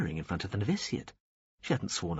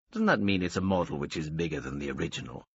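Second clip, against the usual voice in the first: lightly voiced wealthy man; 4 semitones lower than usual. A narrator's reading. Veppers admiring the alien's ship, lightly voiced. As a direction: sound lazily amused.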